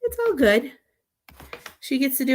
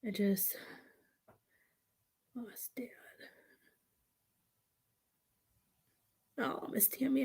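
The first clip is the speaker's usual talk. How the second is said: soft unvoiced whisper; 16 dB quieter than usual. Real, not acted. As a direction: whisper it under your breath. Whispers while off mic.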